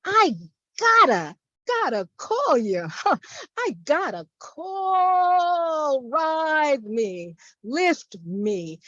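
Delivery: incantatory reading with stretched words and sudden high lifts; poetry recitation. Acted. Poet performing a poem for a library audience, incantatory. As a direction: declaim it phrase by phrase, drawing out key words. Reads own poem aloud.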